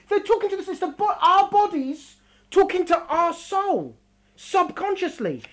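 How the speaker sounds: ordinary delivery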